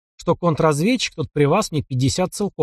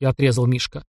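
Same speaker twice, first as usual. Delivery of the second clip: plain reading voice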